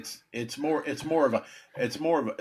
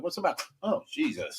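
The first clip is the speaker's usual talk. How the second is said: higher, softer recoil at a bad smell; disgusted surprise; 8 semitones higher, 6 dB quieter than usual. Pest tech sniffing rancid dog biscuits, quiet higher recoil. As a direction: pull back from the rancid biscuits.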